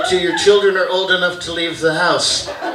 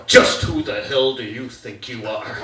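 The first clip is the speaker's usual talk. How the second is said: low mock-stern dad; 6 semitones lower than usual. Dad challenges his son, stern and low. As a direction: demand it like an indignant father. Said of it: "my dad just pulled this one".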